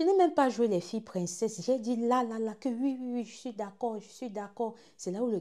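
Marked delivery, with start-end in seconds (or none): none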